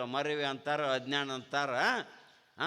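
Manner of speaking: normal talking voice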